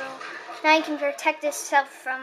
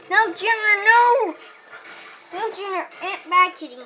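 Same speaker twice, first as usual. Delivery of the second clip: loud, high scolding shout; scolding the cat; 5 semitones higher, 3 dB louder than usual. Real, not acted. Cat interrupts the game.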